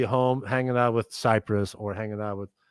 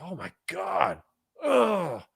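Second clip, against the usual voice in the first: awed; 4 semitones higher, 4 dB quieter, much slower than usual. Retold or imitated. Reacting to hearing the beat.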